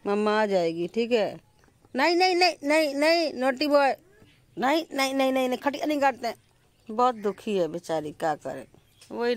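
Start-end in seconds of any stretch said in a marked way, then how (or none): 2.0-6.4 high, quick scolding nos; scolding the puppy. Real, not acted. Owner telling the puppy off for chewing the cot, high scolding. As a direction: tell the naughty puppy off.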